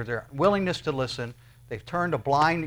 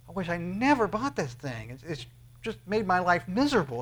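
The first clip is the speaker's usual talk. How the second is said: mock regret; 5 semitones higher than usual. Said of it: "you're going".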